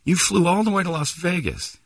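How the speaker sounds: high-pitched mock amazement; imitated incredulous reaction. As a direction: voice lifts in exaggerated disbelief. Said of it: "And I'm like".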